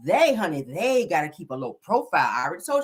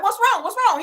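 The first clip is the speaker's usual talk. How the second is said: much higher, anxious repeated question; mock worried mom; 12 semitones higher, 5 dB louder, faster than usual. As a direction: pretend to fuss over the child in a high voice. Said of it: "Okay, she's like".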